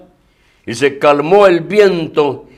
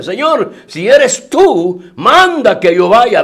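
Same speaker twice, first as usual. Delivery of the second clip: higher, slow plea; Peter calling out; 6 semitones higher, 4 dB louder, slower than usual. Retold or imitated. Peter asks Jesus to call him, slow and pleading. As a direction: call out slowly, asking permission.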